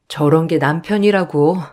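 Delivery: ordinary talk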